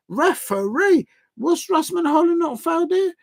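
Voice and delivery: high, pleading cry for a foul; appeal to the referee. Real, not acted.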